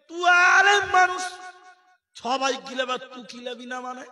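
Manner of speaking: loud, high furious yell; angry uncle. Acted. Elder scolding the son who refuses, angry yell. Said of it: "চাচা রেগে গিয়ে বলছে".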